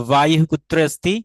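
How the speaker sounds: 'vayuh kutra asti' for বায়ু কুত্র অস্তি; loud, careful Sanskrit বায়ু কুত্র অস্তি; Sanskrit recitation. Shown as a demonstration. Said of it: "এরকম যেমন". (সংস্কৃত উচ্চারণে বায়ু কুত্র অস্তি)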